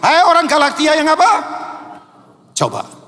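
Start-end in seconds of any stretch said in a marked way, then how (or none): 0.0-1.3 high, forceful call-out; raised-voice scolding with no hesitation; blunt rebuke. Acted. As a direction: call them out bluntly, rising to a question. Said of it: "ngelangsung ngomong begini pasti".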